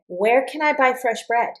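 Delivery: "Where can I buy fresh bread?" high at first, dropping on BREAD; falling question intonation. Shown as a demonstration. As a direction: repeat the information question, pitch falling to BREAD. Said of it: "If the question goes da da da".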